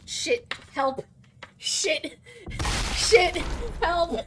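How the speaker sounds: rising panicked shouts for help; panicked yelling. Real, not acted. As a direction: yell out in real panic. Panic as keyboard dies.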